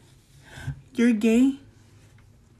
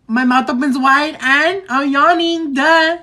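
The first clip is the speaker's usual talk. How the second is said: usual speaking voice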